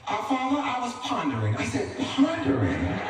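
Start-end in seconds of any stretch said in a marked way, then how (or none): 0.1-1.6 higher, formal bougie son; Hassan. Acted. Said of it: "He said". 2.0-3.0 high, slow disbelieving question; incredulous echo. Acted. Dad echoes son's fancy word.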